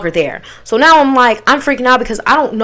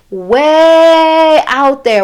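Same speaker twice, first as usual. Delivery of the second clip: WAY hugely stretched, high and loud; drawn-out, emphatic WAY; exaggerated distance; 4 semitones higher, 4 dB louder, much slower than usual. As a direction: hold "way" long before "out there". Exaggerating how far she parked.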